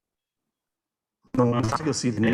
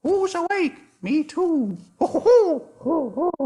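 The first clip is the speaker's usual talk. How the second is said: high hooting owl voice, slow on the ho's; great horned owl; slower than usual. Shown as a demonstration. Teacher voicing the great horned owl for the class, high hooting. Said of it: "the great horned owl who says".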